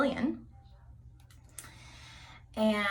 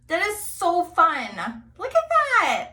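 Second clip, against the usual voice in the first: much higher and louder, slow delighted outburst; thrilled squeal; 9 semitones higher, 11 dB louder, slower than usual. Real, not acted. Vlogger showing off the finished braid, high thrilled exclamation. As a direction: gush over it, pitch shooting up.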